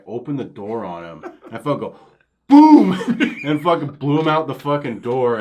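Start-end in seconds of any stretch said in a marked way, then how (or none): 2.5-2.9 explosive high, loud boom; imitated gun blast. Player blasting a hacker out the door, explosive. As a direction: burst it out like a shotgun going off.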